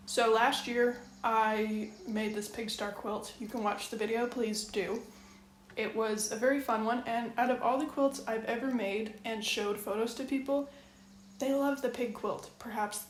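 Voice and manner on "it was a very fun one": normal talking voice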